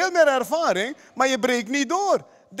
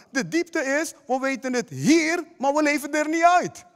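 usual speaking voice

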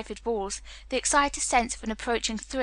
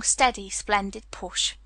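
usual speaking voice

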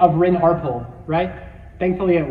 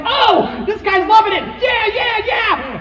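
high, whooping mock excitement; hammed-up, high-pitched hollering; imitating thrilled dad; 13 semitones higher, 4 dB louder, slower than usual. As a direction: cheer like a dad loving the ride. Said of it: "just having a great time".

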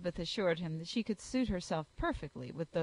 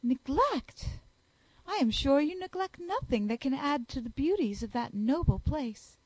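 higher, gushing society lady; high, affected voice of a flattering lady; fawning Miss Bingley; 4 semitones higher, 3 dB louder than usual. Acted. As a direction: flatter him shamelessly.

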